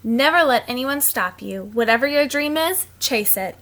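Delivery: usual speaking voice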